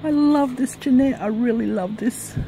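high-pitched, overwhelmed delight; high burst of grateful emotion; overjoyed. Real, not acted. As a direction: gush with real, heartfelt delight. Overwhelmed thanks for a gift.

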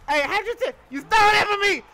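ordinary delivery

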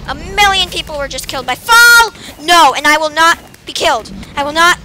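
loud, insistent refusal to die; loud defiant panic. Real, not acted. Gamer shouting that the game won't kill him.

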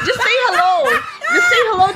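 high, loud laughing plea; mother, laughing, tells her son to say hello; laughing urge. Real, not acted. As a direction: laugh while you urge him on.

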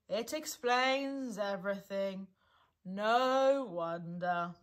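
slow, mournful resignation; gloomy. A narrator's reading. Eeyore brooding over his lost tail, gloomily. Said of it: "said Eeyore gloomily".

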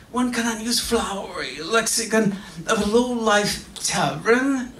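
prim, indignant columnist voice pitched high; haughty letter-writer. Retold or imitated. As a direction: read the rebuke like an offended gentleman. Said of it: "like this with the following one from Daniel Saldana".

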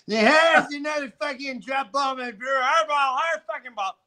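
high, loud sports yell; mock shouting Norm. Norm calling his own ball, mock shouting. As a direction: yell it like a cheating teammate.

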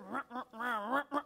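low mock grumble; imitated grumbling. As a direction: grumble wordlessly under your breath. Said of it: "She starts grumbling".

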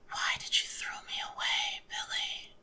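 eerie, breathed whisper; unearthly whisper. A narrator's reading. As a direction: whisper it like an apparition. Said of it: "In an unearthly whisper, she breathed".

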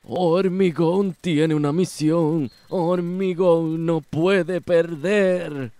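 loud, slowing, determined shout; desperate battle yell. Acted. Villain refusing to lose the fight, loud determined yell. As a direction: push every word out at full force.

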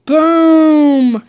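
loud triumphant boom. Real, not acted. Gamer shouts boom dropping a card. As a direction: slam it down with glee.

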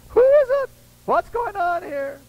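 high, loud alarmed captain; high alarmed call from the captain; excited captain. Retold or imitated. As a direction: demand who's to blame. Captain voiced in retold story.

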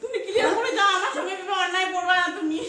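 upset protest. Real, not acted. Woman demands the man settle up before leaving, upset protest. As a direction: argue it out, loud and high. Heated dispute over money and leaving.

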